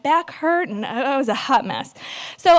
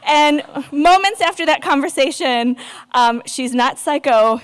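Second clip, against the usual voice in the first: ordinary talk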